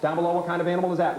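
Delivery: rapid-fire quiz question; breakneck pace. Shown as a demonstration. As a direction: fire the question off fast, teacher drilling a class. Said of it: "moving at this breakneck speed".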